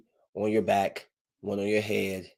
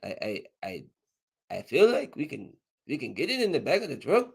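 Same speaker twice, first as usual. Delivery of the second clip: put-on Mexican accent. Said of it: "I can see the Mexican like".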